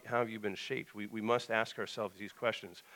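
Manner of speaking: usual speaking voice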